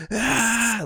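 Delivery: high, loud, raspy mock belt; raspy belted scream. Showing the singer's new raspy scream, mock belt. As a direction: imitate a belted scream with rasp. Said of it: "that rasp in the voice, like".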